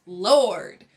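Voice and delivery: loud exasperated "Lord" with a falling groan. Real, not acted. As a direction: throw up your hands and call "Lord" out loud. Exasperated at the baby chaos.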